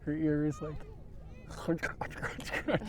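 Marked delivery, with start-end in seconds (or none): 1.5-2.9 whispered crunch noises; crunching sounds. Retold or imitated. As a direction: mimic a dog chewing. Imitating dog crunching turds.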